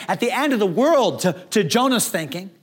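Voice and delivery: ordinary talk, nothing marked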